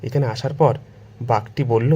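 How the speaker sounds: ordinary delivery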